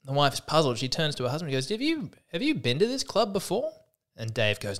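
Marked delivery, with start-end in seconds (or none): 1.7-3.7 suspicious wife. Acted. Wife questions husband, puzzled.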